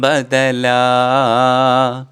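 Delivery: slow, lilting recital of Arabic verse; teacher reciting the Arabic poem to the class, slow and melodic; poem recitation. Acted. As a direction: chant the verse slowly, savouring each line.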